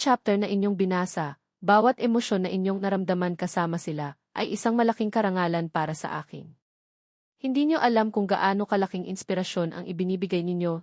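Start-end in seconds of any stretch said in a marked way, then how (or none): none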